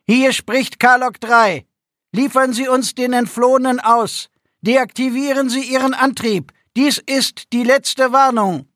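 tinny radio voice over the speakers. A narrator's reading. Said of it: "Wieder erklang die blecherne Stimme".

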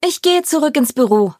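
mildly calling out, pitched high and falling; calling after. A narrator's reading. Rory to departing friends, calling after them. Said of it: "rief Rory uns hinterher".

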